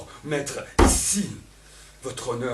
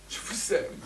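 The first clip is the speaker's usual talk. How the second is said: unvoiced whisper after the shout; 8 semitones higher than usual. Elvire confessing love to Don Juan, in a whisper. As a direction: drop to a breath of love.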